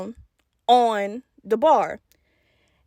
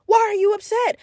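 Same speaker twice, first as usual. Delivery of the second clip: indignant protest; 8 semitones higher than usual. Retold or imitated. Hurt child protesting mom's attitude.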